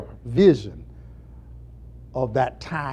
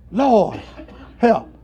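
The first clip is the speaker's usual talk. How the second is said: short, higher-pitched plea with falling ends; plaintive cry; 8 semitones higher, 5 dB louder than usual. Shown as a demonstration. Preacher showing how to pray for others, a plaintive cry. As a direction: call out for help, one word at a time. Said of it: "call out a plaintive cry".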